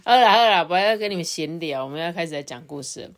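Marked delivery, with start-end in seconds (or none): none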